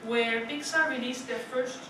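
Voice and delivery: ordinary delivery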